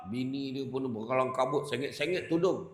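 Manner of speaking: usual speaking voice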